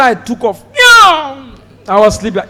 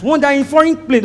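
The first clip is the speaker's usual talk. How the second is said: usual speaking voice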